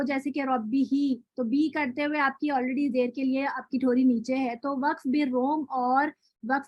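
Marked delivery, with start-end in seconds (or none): 0.4-1.1 ربہ recited slowly, the ب doubled and the ending held; careful tajweed reading of ربہ; Quranic recitation. Shown as a demonstration. Teacher recites an example for the students, slowly. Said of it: "یا کسرہ ہو".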